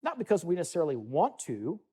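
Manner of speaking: ordinary talk, nothing marked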